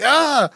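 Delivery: very high, loud mock scream; imitated scream. Startled visitors answer Igor in the basement, high mock scream. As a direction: shriek back in mock fright. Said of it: "And they're like".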